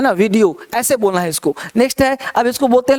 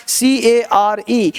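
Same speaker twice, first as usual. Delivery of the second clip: ordinary talk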